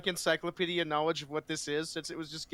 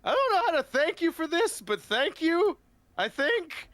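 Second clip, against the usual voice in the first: put-on awed young paladin; 11 semitones higher, 4 dB louder than usual. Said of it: "he just looks at Owen like".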